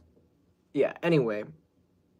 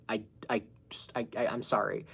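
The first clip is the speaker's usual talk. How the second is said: awkward whisper; 5 semitones lower, 9 dB quieter than usual. Retold or imitated. Rejecting him, awkward apology.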